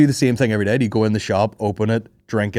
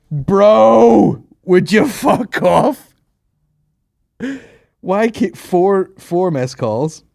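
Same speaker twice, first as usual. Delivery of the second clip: high, loud and slow, fed up with calls; exasperated; 10 semitones higher, 7 dB louder, much slower than usual. Real, not acted. Fed up with repeated missed calls, high loud and slow. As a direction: drag it out, annoyed at the phone.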